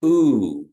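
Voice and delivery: long rounded ooh; teacher models the U sound; Kiowa ooh. Shown as a demonstration. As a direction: say ooh with lips pushed forward. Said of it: "it's a double O".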